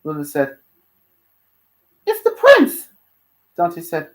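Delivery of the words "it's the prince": high, loud exclamation of surprise; surprised. Acted. Luna spotting the prince of hell, loudly surprised. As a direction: cry out in astonishment. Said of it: "Luna looked surprised when she saw him".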